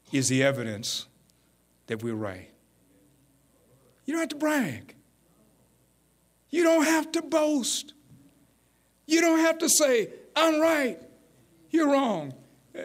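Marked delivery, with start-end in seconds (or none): 4.1-12.3 higher exhortation. Acted. Preacher's rising exhortation.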